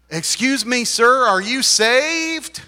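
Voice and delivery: high, loud, drawn-out question; imitating an eager soul-winner; mock street evangelist. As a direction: accost a stranger with earnest zeal. Acts evangelist accosting a stranger.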